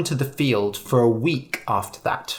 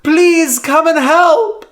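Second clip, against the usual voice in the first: high, loud panicked cry for help; boy screaming for help from the wolf; terrified scream; 9 dB louder, slower than usual. A narrator's reading. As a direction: scream for your life. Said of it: "Lyman screamed".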